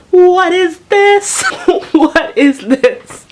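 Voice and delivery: high, loud, drawn-out outburst; screaming. Retold or imitated. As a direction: scream it slowly in outraged disbelief. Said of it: "I don't know what I'm screaming".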